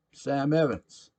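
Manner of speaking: put-on drunk patron booming his name; loud proud name. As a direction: announce himself like a big shot. Sam introduces himself loudly.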